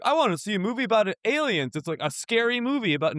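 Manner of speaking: high, quick voice of an eager audience member; imagined moviegoer. Acted. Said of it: "people are gonna go in here and be like".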